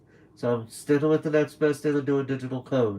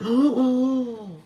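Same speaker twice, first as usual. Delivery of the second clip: mock shocked gasp; 10 semitones higher than usual. Mock fan reacting to the name.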